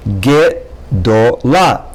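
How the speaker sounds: "gedola" slowly, ge as its own syllable; ge-do-la, slow and separated. Shown as a demonstration. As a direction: stretch out "gedola" beat by beat. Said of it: "just the sound ge is already a syllable".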